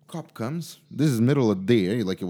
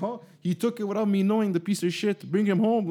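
annoyed uncle; 10 semitones higher, 4 dB louder than usual. Retold or imitated. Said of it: "So his uncle's like".

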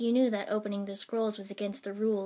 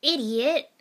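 loud, higher-pitched rebuke; exasperated shouted scold; 5 semitones higher, 10 dB louder than usual. A narrator's reading. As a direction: tell them off sharply. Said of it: "Ino scolded".

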